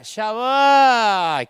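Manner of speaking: loud drawn "ya va"; lazy kid stalling. Retold or imitated. Son stalling his parent again, loud lazy reply. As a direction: put them off again.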